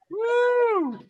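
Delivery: high loud cheer; excited whoop. Real, not acted. Host cheering his teammate, a high loud whoop. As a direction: whoop for the winner.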